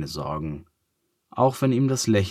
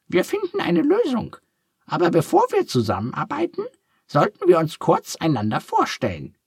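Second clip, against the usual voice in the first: very high, squeaky little duck, curious; a cheerful duck asking kindly; 4 dB louder than usual. A narrator's reading. Duck takes charge.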